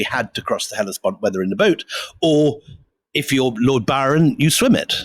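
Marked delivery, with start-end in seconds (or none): none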